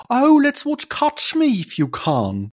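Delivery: put-on high, slow Carlisle accent, A's turned into R's. Friend at the cinema suggesting a film, high slow Carlisle accent. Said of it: "their A's are just R's".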